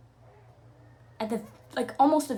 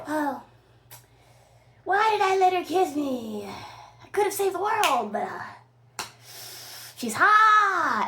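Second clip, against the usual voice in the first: mock lament; 7 semitones higher, 5 dB louder than usual.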